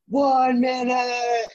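high, slow shout over the rotors; shouted warning. Retold or imitated. Crew chief shouting the time warning to troops, slow and loud. Called out on the helicopter.

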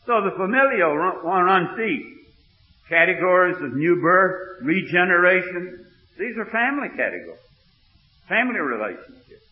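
loud, high, driving sermon voice; fired-up revival preaching; preaching at full force. Acted. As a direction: preach it to the back pew.

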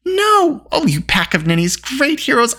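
high, exasperated outcry; indignant scolding. Acted. Eilonwy berating the pack of ninnies, indignant.

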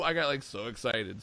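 normal talking voice

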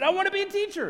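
high, loud hammed-up eagerness; over-eager retold yell; eager shout. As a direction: shout it back like a keen student. Retold eager shouted reply to professor.

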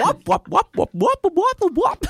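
high, bouncy wop sounds; imitating goofy music. Shown as a demonstration. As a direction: mimic a silly synth line. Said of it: "that really strange Harley Quinn music".

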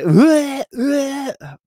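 high, loud retching "bleh"; mock gag. Friend gagging in the car, loud mock retch. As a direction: fake a gag at the stink.

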